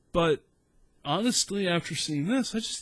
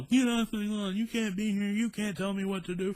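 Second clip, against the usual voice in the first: imitated yell; 7 semitones higher than usual. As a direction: holler at cops in a raised, put-on voice. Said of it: "point the thing at the cops and yell".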